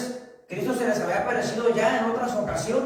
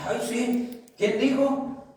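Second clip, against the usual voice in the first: higher, mocking ay sí; sarcastic retort; 4 semitones higher, slower than usual. A worker brushes off a coworker, sarcastic scoff. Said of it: "Y usted voltea y dice".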